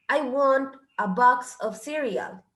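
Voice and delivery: slow, careful model sentence with each word spaced out. Shown as a demonstration. Teacher slowly modelling the sentence for Spanish-speaking students. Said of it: "No puedo contar el cereal".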